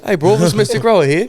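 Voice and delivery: high, loud mock British voice; hammed-up British bloke; British accent. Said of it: "You got the British".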